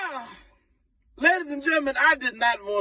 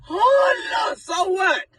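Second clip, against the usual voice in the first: exasperated shout; 12 semitones higher, much slower than usual. Real, not acted. Mocking the crying women.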